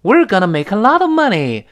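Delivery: high, loud excited line; thrilled about the money; mock excitement. Shown as a demonstration. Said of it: "惊讶或者赞赏".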